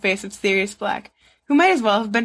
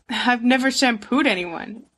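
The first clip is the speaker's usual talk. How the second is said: ordinary delivery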